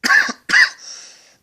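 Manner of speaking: real hacking cough; a sharp loud cough. Real, not acted. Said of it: "I'm coughing a lot".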